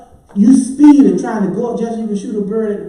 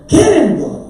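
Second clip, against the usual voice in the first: high loud "get him" call; egging on; 3 semitones higher, 6 dB louder than usual. Acted. Moviegoer yelling at the screen in excitement. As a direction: cheer on the screen character.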